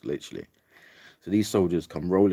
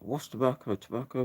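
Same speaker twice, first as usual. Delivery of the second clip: much higher, questioning soldier voice; puzzled soldier; 9 semitones higher than usual. Retold or imitated. Soldier holding her tobacco, baffled. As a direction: mimic the baffled soldier, pitched up.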